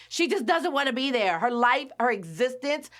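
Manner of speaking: normal talking voice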